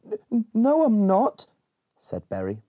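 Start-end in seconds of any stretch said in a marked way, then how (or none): 0.0-1.5 stammering scared high voice, a bit louder; trembling. A narrator's reading. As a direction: stutter out a frightened denial. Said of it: "trembling on the river bank".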